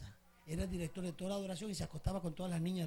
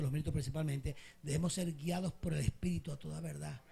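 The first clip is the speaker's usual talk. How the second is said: ordinary delivery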